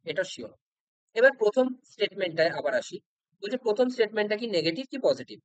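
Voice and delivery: normal talking voice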